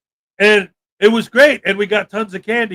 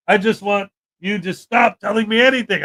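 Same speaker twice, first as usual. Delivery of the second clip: usual speaking voice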